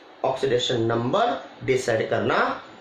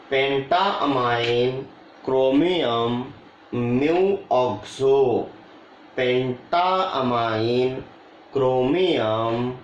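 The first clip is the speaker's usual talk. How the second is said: each term drawn out and separated; slow dictation; 2 semitones lower, much slower than usual. Real, not acted. Teacher reading the full name off the board, slow dictation. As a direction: read it out slowly, term by term.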